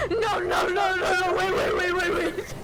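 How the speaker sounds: high-pitched imitation of someone flustered; mock panicked protest. Teasing a friend by imitating them, mock panic. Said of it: "You're full on pulling the".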